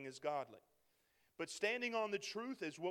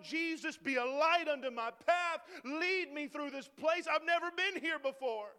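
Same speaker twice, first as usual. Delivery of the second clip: loud, pleading prayer, slowing on lead me; desperate prayer; 7 semitones higher, 6 dB louder than usual. Retold or imitated. Believer lost in his battle praying to Jesus, loud and pleading. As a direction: cry out to God for guidance. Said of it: "with my eyes closed going".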